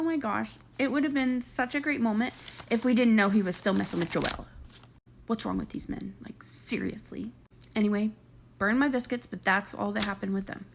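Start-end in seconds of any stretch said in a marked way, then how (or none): 5.3-7.3 hushed disbelieving whisper. Real, not acted. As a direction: lean in and whisper it. Aside about cheating men.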